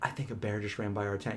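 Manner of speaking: hushed. Retold or imitated. Telling her partner a bear just passed, hushed. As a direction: tell him quietly so the bear won't hear. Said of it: "I'm just being real quiet".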